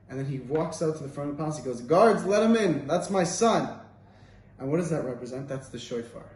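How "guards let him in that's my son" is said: loud, slow royal command; commanding king. Retold or imitated. King calling to his guards, loud slow command. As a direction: order the guards, big and slow.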